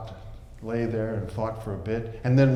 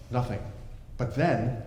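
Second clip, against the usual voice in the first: ordinary delivery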